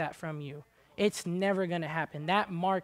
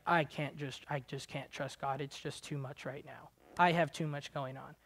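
mock defeated murmur; 4 semitones lower, 6 dB quieter than usual. Mocking defeated believer.

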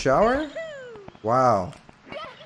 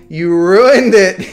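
louder, slow, stretched complaint; drawn-out loud whine; 4 semitones higher, 8 dB louder, much slower than usual. Real, not acted. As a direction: drag it out and wail it. Streamer repeats complaint, building up.

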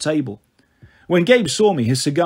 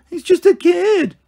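high, slow, sneering falsetto mimicry; mocking falsetto; 5 dB louder, slower than usual. A narrator's reading. Gabe mocking Eddie's defense in falsetto. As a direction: mimic him in a squeaky falsetto to mock him. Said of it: "mimicked in a falsetto voice".

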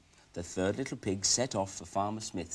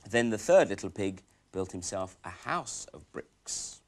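ordinary delivery

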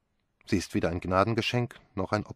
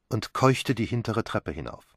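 ordinary delivery

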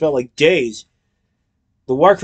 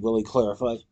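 usual speaking voice

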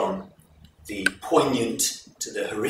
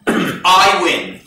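loud, slow and higher, a gambler king's boast; king's dice challenge; 7 semitones higher, 12 dB louder, slower than usual. Acted. King daring his new wife to dice, loud and slow. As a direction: boom it out slowly like a reckless king daring his bride.

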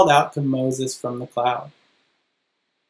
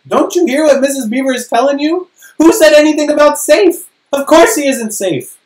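high and louder, indignant beaver; 11 semitones higher, 8 dB louder than usual. Acted. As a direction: scold as an outraged little beaver.

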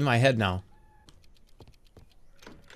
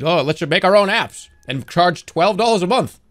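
raised, louder mocking quote; hammed-up higher imitation of corporate deciders; mock cable companies; 4 semitones higher, 5 dB louder than usual. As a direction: mimic scheming companies with their bright idea. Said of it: "all the cable companies decided".

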